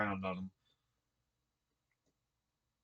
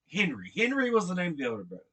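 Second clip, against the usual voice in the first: ordinary delivery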